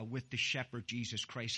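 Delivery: ordinary talk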